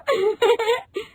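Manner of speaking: high rising giggle; quick bright giggle; bride's happy giggle. Acted. Rinki giggles.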